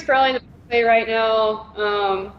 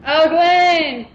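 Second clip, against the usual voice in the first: excited; 6 semitones higher, 4 dB louder than usual. Real, not acted. Playful reply to fan comment.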